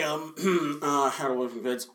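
soft, garbled mumble after clearing the throat; evasive mumble. Retold or imitated. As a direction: slip it out indistinctly, hoping nobody catches it. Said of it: "I didn't quite get that".

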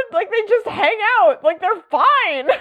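high-pitched, laughing insistence; amused exasperation bubbling into laughter; laughing protest. Real, not acted. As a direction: protest it while cracking up, voice way up.

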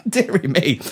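put-on high fluttery old-man dither; flustered old man. Old Prime Minister flustered at a mishap. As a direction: dither like a startled old gent.

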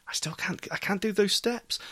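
hushed confession; whispering. Real, not acted. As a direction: whisper it sheepishly. Whispered admission.

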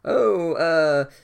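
hammed-up dad-joke taunt; dorky dad voice. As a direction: tease them like a corny dad. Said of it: "the world's dorkiest dad".

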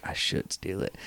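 whispered dawning agreement; conspiratorial whisper. Retold or imitated. Drunk buddy agreeing to steal a hat, conspiratorial whisper. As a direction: whisper back as a willing accomplice.

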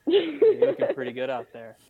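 high, laughing mock flattery; sweet nothings. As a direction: coo a tease at the opponent. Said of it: "whisper sweet nothings in their ear to distract them".

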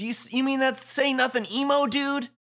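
high-pitched stammering disbelief; incredulous. Acted. Fret asking about the silent emo dude, incredulous. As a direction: question it high, tripping over the start.

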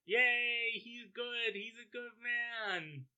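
very high, soft squeal; gleeful cheer. Real, not acted. Cheering that he's a good man, gleeful.

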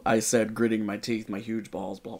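ordinary talk

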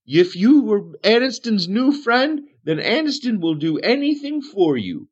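high, sing-song hermit voice; Aniston, eager for a friend. Acted.

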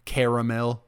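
normal talking voice

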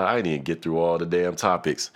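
ordinary delivery

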